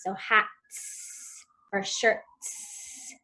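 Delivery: "hats" and "shirts" very slow with a long hissed final S; teaching the plural S by holding it out; stretched final S. Shown as a demonstration. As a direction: draw out the S at the end of "hats" and "shirts". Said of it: "draw that S sound out at the ends of words".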